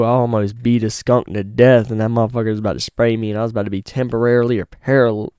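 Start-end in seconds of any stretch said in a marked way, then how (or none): none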